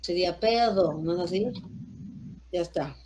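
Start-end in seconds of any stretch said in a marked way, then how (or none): none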